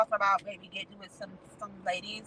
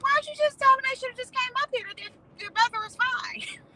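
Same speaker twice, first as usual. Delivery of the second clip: much higher, sarcastic disbelief; mock incredulous retort. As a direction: throw the question up high in mock disbelief. Her own retort, incredulous.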